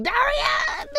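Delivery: a high, delighted cry of a name; squealed hello to a friend; excited greeting. Real, not acted. Greeting a live chat viewer.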